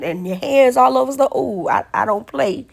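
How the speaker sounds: normal talking voice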